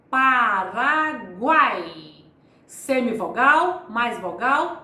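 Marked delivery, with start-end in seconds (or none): 0.1-1.9 Paraguai spoken slowly and clearly, every vowel drawn out; slow example word. Shown as a demonstration. Teacher presenting an oral triphthong example, slowly. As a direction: spell out Paraguai slowly by ear for students. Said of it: "Eu tenho o tritongo oral".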